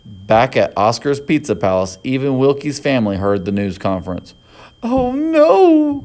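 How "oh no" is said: high, worried mother's cry; distressed cry. A narrator's reading. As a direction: cry out in dismay. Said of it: "cried Wilkie's mother".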